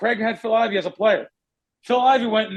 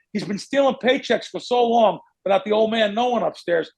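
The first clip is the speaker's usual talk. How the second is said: normal talking voice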